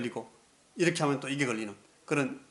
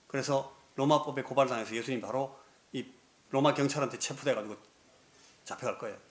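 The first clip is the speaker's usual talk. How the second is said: ordinary delivery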